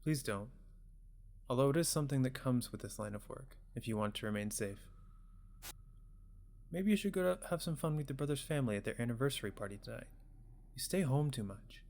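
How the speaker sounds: put-on soft-spoken parent checking in on a child; gentle parent. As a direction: voice a caring parent, quietly. Voices parent talking to child.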